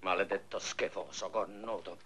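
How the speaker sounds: soft, under-the-breath insult; muttered curse. Acted. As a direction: curse him quietly to herself. Insults after he leaves. (imprecazione borbottata sottovoce)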